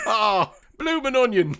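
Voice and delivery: hammed-up Australian rapture over food; mock Australian accent. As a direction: gush like a homesick Australian, laughing. Mock Aussie praising bloomin' onion.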